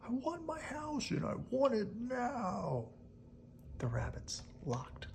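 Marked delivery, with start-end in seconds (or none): 0.1-2.9 high, slow demanding whine; slow, high mock tantrum; tantrum wolf. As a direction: demand it like a spoilt child. Wolf's temper tantrum.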